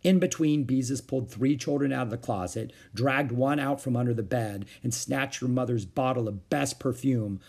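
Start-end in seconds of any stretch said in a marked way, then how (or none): none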